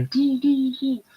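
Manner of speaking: high monotone "doo" noises; imitated sound effect. Shown as a demonstration. Vlogger showing what the impressionist doesn't do, high mimicked beeping. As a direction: mimic a silly electronic effect, high and even. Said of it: "he don't go".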